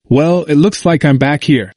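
usual speaking voice

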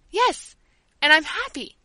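high, loud happy cry; a child's loud glee; gleeful. Acted. Child declaring her joy.

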